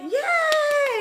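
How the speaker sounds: very high sarcastic cheer; mock cheer. Reacting to a client's scratches, high mock cheer. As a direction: squeak out a fake yay. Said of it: "I'll be like".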